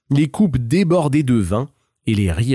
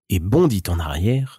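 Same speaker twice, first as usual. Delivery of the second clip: ordinary delivery, nothing marked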